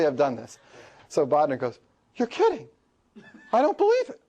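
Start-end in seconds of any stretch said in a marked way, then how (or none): 2.2-4.2 very high, imitated disbelief; freaking out. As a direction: play a student squeaking in stunned protest. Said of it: "And he's freaking out saying".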